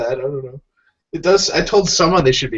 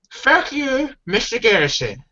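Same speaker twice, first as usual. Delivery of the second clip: much higher, bratty kid cartoon voice; Cartman voice; 10 semitones higher, slower than usual. Friend quoting South Park, Cartman swearing at his teacher, high and bratty. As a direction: squeak it out like a cartoon brat. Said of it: "reciting a scene from South Park".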